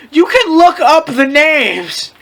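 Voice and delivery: exasperated aside. Real, not acted. Reader telling listeners to look up names, exasperated. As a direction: drag it out to the listeners.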